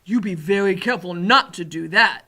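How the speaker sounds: loud, emphatic giant voice; warning giant. A narrator's reading. Giant warns the girl in his ear, loud and emphatic. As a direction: warn firmly as a big giant.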